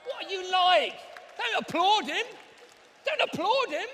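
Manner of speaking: loud, high mock-furious shout; mock outrage. Presenter scolding the crowd for applauding, mock furious shout.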